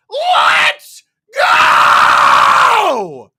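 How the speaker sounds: huge high scream, long drawn out; full-throated hype yell on request; rallying shout. Shown as a demonstration. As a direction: scream it as a stretched-out battle cry. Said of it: "can we get a "Let's go".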